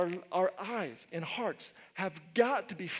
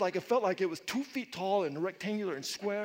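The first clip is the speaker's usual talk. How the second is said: normal talking voice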